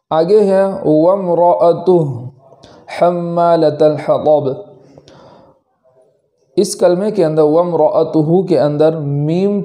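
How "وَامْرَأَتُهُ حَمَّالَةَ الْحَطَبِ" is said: tajweed recital; Quran recitation. Acted. Recites verse.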